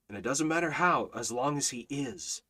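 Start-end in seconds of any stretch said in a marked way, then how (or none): none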